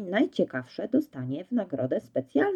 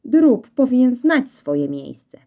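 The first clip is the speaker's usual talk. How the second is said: slow, lofty and louder, looking down on him; haughty; 6 semitones higher, 5 dB louder, slower than usual. Acted. Dog Azor lecturing Reksio on his place, haughty. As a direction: talk down to the puppy from above. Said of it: "spoglądając na Reksia z wyższością".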